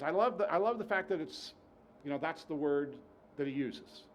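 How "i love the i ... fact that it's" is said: ordinary delivery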